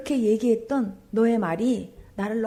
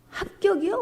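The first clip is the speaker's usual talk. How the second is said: higher, rising, stunned question; surprised question; 8 semitones higher than usual. Retold or imitated. A woman, crying, asking God back in disbelief. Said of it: "울면서 제가".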